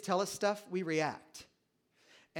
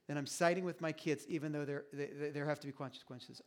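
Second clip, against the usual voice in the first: normal talking voice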